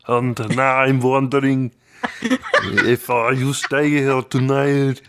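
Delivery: Arnold. Shown as a demonstration. Co-host in an accent guessing game, Arnold impression. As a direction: drag the lyric out in a thick German accent.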